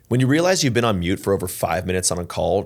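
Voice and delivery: ordinary talk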